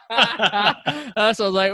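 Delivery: high laughter spilling over words; real laughter while telling the story; laughing. Real, not acted. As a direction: laugh through it, slowly.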